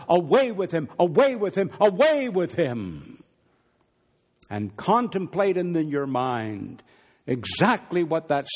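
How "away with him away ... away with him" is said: mob yell building louder and louder; crowd shout. Retold or imitated. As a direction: cry it out like an angry mob. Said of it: "louder and louder".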